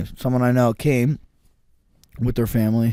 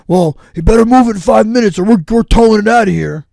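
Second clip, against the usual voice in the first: loud, high, bossy ranger threat; snotty ranger; 9 semitones higher, 10 dB louder than usual. Retold or imitated. Retelling the park ranger threatening to tow the truck, loud and high. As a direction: bark it out like a jerk with a badge. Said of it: "Like a dick".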